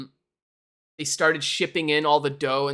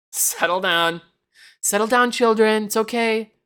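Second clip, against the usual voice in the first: higher, louder pretend soothing; mock calming; 6 semitones higher, 6 dB louder than usual. Streamer pretends to calm rowdy chat like a babysitter, louder and higher. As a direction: hush unruly kids in jest. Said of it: "I had to calm the chat down".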